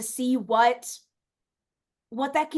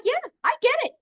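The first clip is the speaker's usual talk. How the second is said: high, louder cheery reply; high playful reply; 13 semitones higher, 3 dB louder, much faster than usual. Retold or imitated. Answering a grateful friend, high cheery chirp.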